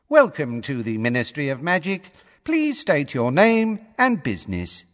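high, cool recorded-greeter voice; cool female voice. A narrator's reading. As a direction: welcome visitors in a cool, official female voice. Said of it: "the cool female voice".

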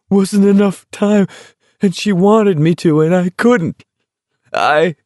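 high, tearful wailing voice; weeping. A narrator's reading. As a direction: wail it out, close to tears. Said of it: "he was weeping and staggering".

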